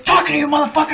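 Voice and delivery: very high, hollered order; imitated sergeant shout. Sergeant silencing the marine, mock furious shout. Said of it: "He's like".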